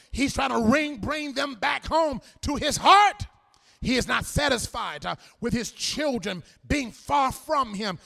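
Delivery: loud, rolling preacher's cadence; preaching at full force. Real, not acted. Preacher rousing the congregation about healing the outcasts, full-force preaching. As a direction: preach it out to the whole church.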